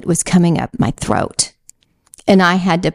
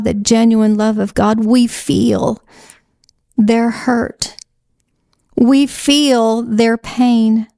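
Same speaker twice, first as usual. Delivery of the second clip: voice breaking; 4 semitones higher, slower than usual. Real, not acted. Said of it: "And I broke down".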